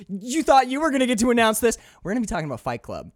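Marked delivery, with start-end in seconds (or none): 0.1-1.8 high, loud, fast gloating; gloating taunt. Pretend. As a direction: crow it like you stole her thunder. Mock taunt, hijacking her podcast.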